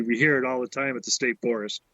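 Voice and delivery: normal talking voice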